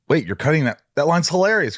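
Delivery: replaying his own shocked protest, pitch climbing; indignant disbelief. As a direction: voice jumps high in retold protest. Protesting the director's line cut.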